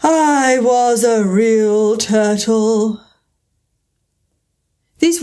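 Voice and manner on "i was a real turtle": very slow, sighing and mournful; deep sigh. Acted. Mock Turtle confesses his past to Alice, sighing. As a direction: let each word sink with sorrow. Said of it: "with a deep sigh".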